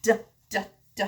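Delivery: "D, d, d." as a short bare duh sound; "d" tapped out as the sound, not the name; letter D sound. Shown as a demonstration. Parent demonstrating "D, d, d." to a child. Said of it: "D sounds like this".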